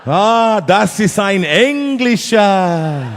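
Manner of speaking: loud, slow German remark, with a laugh; knowing German aside, drawn out; German answer. Retold or imitated. German audience member explains, loud and slow in German. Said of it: "German go".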